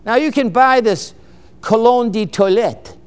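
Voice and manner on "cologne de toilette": cologne de toilette in a playful mock French accent; lightly Frenchify cologne de toilette, ko-LONE duh twa-LET; mock French accent for a fancy perfume name. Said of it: "You have to say it with a French accent".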